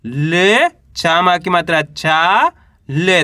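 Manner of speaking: syllables stretched, rising on बो and चा; slow sounding out. Shown as a demonstration. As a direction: spell it out slowly so kids can follow. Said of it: "कैसे पढ़ेंगे?". (बो और चा पर सुर उठाकर धीरे धीरे पढ़ना)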